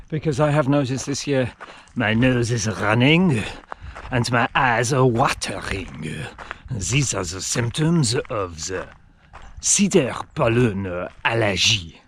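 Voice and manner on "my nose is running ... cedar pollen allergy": a slow, lightly put-on French accent; outrageous French accent. Vlogger describing his hay fever in a mild mock French accent. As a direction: hams up the allergy symptoms in a mild French accent. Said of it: "Why are you doing that in an outrageous French accent?".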